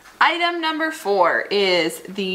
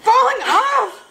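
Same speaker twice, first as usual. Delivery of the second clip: sudden high, loud yelp of surprise; real surprise; 12 semitones higher, 5 dB louder than usual. Real, not acted. Sandwich spilling while she cuts it, a loud high startled cry. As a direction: cry out as the sandwich falls apart. Food falling apart while cutting.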